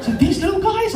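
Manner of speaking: very high mock-cute voice; instructor's cooing. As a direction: show off the little creatures in a high voice. Instructor showing harmless jellyfish, acted.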